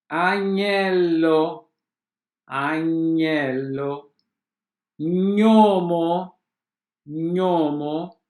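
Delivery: agnello, gnomo and spegnere with "nh", agnello very slowly. Shown as a demonstration. Teacher to students drilling gn as nh. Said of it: "G N igual a N H".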